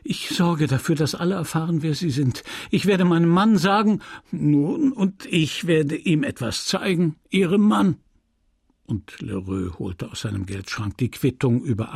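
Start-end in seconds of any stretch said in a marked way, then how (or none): 0.0-4.0 higher-pitched, agitated woman; outraged warning, rising in force; indignant threat. A narrator's reading. Emma threatens the merchant. 4.3-8.0 high-swinging smug menace; taunting, raised-pitch warning; gloating threat. A narrator's reading. Merchant threatens her back.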